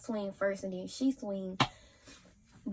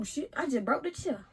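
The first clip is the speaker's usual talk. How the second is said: ordinary talk, nothing marked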